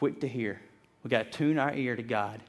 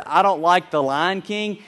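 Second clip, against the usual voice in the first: slow, louder mock whine from the crowd; mock complaint; 4 semitones higher, 7 dB louder, slower than usual. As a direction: parody a listener grumbling. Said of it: "I heard".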